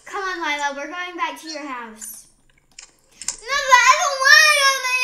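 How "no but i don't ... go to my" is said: loud, high, fast wailing protest; whining. Acted. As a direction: wail like a child refusing to go. Child refusing to go home.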